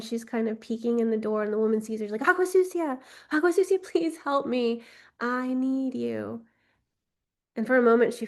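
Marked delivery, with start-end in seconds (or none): 2.3-6.5 desperate plea. Acted. Woman in labor begs for help.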